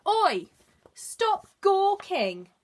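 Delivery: high, loud, slow angry bark; barking badger. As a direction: bark it at the nosy bear. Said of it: "barked the badger".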